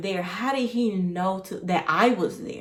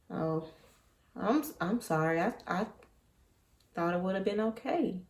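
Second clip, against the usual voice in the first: soft, much quieter mock apology; mock sad; 9 dB quieter than usual. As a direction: imitate a hangdog sorry, hushed. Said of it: "act surprised and then act sad".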